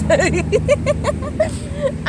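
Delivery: high, breaking-up giggle; laughing. Real, not acted. Vlogger retelling the officer's question about ants, laughing. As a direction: burst out giggling at your own story. Laughing at her own story.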